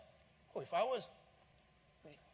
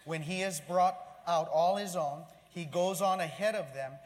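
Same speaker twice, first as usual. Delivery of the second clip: scripture reading aloud; 3 semitones higher, 9 dB louder than usual. Acted.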